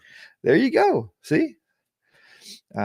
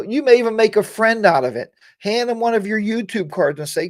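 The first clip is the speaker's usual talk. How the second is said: usual speaking voice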